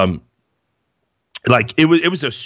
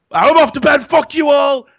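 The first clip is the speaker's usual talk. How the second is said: retold furious shout; 6 dB louder than usual. Shouting at his teammates at the pool table, furious and loud. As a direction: blow up at the teasing lads. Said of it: "I just, like, lost it".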